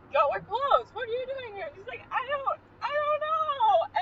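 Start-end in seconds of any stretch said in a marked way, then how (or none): 0.1-1.7 louder, higher mock protest; retold shopkeeper. Shopkeeper telling a stranger they're closed, mock exasperated. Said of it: "And he's like". 2.1-4.0 high, drawn-out helpless wail; mock tearful. Lost girl answering the shopkeeper, mock tearful. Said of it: "And then she starts crying".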